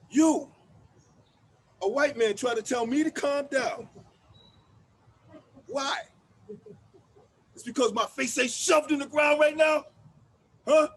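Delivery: angry confrontation. Acted. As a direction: confront him, heat building.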